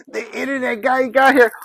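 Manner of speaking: high, loud, thrilled exclamation; excited announcement. Real, not acted. Vlogger celebrating the internet guy's arrival, excited. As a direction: crow it out, pitch jumping up.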